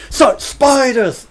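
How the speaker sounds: shouted, drawn out spiders; loud slow spiders. Acted. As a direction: scream it slowly. Mock scare.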